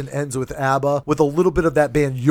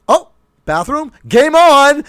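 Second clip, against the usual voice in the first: excited bladder voice; 12 semitones higher, 8 dB louder than usual. Retold or imitated. Road tripper voicing his bladder at the rest area, high and loud. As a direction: cheer like your bladder just saw the toilet. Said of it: "your bladder is like".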